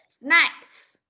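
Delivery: "Snacks" said loudly with a clearly sounded S. Shown as a demonstration. Teacher asking a pupil where the S is, clear S sound. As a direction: model "Snacks", making the S stand out. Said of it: "S đâu?".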